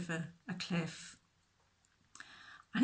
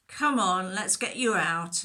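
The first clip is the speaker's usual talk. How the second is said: encouraging; 8 dB louder than usual. Acted. Shepherd reaches the lamb.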